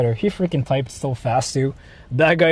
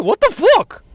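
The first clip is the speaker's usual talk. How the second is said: sudden much higher blurt of disbelief; shocked outburst; 3 dB louder, slower than usual. Real, not acted. Streamer reacting to chat's curry answer, genuinely shocked. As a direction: blurt it out in real shock, pitch jumping up.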